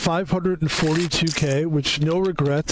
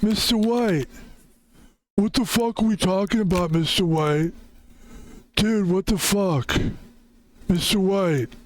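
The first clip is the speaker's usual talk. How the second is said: put-on higher-pitched, exasperated kid protesting; Breaking Bad kid; 5 semitones higher than usual. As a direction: imitate the Breaking Bad kid pleading and cursing in a raised voice. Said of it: "a little bit of the voice of".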